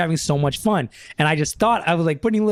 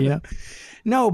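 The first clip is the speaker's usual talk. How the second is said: usual speaking voice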